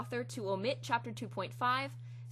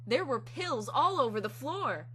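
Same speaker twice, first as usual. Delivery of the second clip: slow, higher, louder exclamation; dramatic exclamation; 5 semitones higher, 6 dB louder than usual. Acted. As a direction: announce it slowly with drama. Dramatic build to joke.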